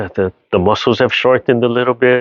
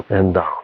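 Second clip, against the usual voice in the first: ha sigh then softer; 4 semitones lower than usual. Shown as a demonstration. Teacher sighing the class down into the stretch. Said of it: "that ha sound".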